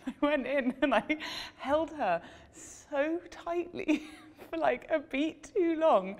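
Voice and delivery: high, slow words broken by laughter; laughing. Real, not acted. Telling the interviewer about the long hug, laughing high and slow. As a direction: laugh through the memory.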